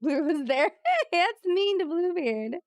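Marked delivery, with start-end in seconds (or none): 0.9-2.5 amused laugh. Real, not acted. Laughing at a mean game joke.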